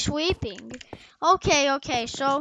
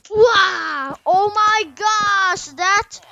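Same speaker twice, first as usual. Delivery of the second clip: high, loud, drawn-out exclamation; big slow whoop of delight; thrilled outburst; 5 semitones higher, 11 dB louder, much slower than usual. Real, not acted. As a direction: shout it out in amazement. Celebrating after the countdown.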